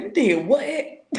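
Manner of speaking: high, drawn-out disbelief; incredulous scolding. Retold or imitated. Big sister reacting to little brother's antics, incredulous scolding.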